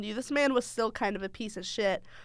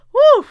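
high, sudden whoop; loud whoop; 17 dB louder than usual. Real, not acted. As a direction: let out a big woo. Exclaims after funny memories.

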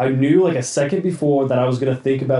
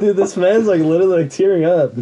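excited teasing; 5 semitones higher, 4 dB louder than usual. Real, not acted. Teasing friend while laughing.

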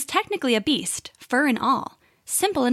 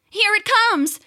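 sudden high excited shout; excited cry; 11 semitones higher, 5 dB louder than usual. Acted. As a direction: cry it out, battle starting. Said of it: "cried the bat".

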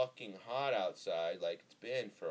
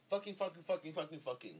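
rapid-fire repetition; 8 semitones higher than usual. Pretend. Blurts repeats after vowing to stop.

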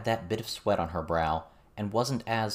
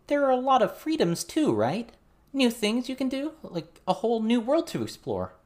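put-on much higher, louder girl's voice, hopeful; eager teen girl; 14 semitones higher, 3 dB louder than usual. Verona insisting to John there are freedoms too, eager and high. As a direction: reach for the bright side.

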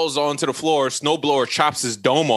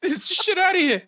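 high-pitched indignant dismissal; comic disgusted outburst, pitched way up; hammed-up angry squawk; mock outrage. Boyfriend throws the doll out.

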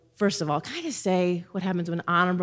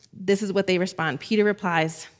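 ordinary delivery

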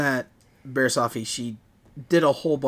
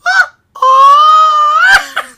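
very loud, high-pitched drawn-out wail; hammed-up shriek imitating a film sound; imitated movie cry; 16 dB louder than usual. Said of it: "where he throws Luke and he goes".

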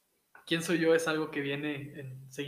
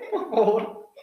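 laughing; 6 semitones higher, 5 dB louder, slower than usual. Real, not acted. Laughing plea.

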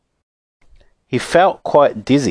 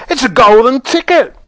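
high, loud shopkeeper's shriek; full-out high-pitched yell; excited scream; 14 semitones higher, 6 dB louder than usual. A narrator's reading. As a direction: scream it out in astonishment. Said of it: "screamed the shopkeeper".

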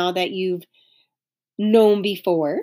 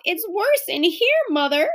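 calling out; 8 semitones higher than usual. A narrator's reading. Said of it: "she called".

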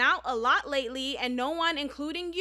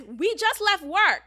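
loud, higher, drawn-out retort; exclamatory; 5 semitones higher, 5 dB louder, slower than usual. Retold or imitated. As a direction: punch it out slowly like a texted exclamation point. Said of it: "exclamation point".

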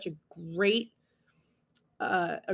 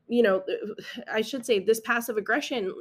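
ordinary talk

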